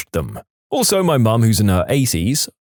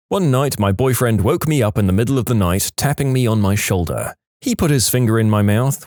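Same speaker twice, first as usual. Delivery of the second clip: ordinary delivery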